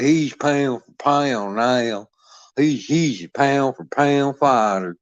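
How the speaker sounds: slow, drawn-out mimicry; mocking quote. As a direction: repeat their phrase with scorn, slowly. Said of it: "So that you say shit like".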